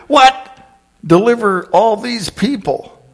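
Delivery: high, disbelieving what; incredulous. Acted. Moses aghast at task.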